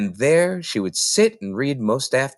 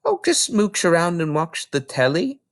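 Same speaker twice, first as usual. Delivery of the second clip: put-on lightly raised little girl voice; a small girl answering the librarian; Matilda, young girl; 7 semitones higher than usual. Little Matilda explaining to Mrs Phelps, young girl voice.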